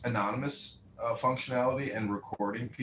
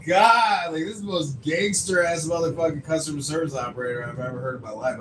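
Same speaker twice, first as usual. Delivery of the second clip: stunned disbelief; 6 semitones higher, 8 dB louder than usual. Retold or imitated. Stunned caller reacts.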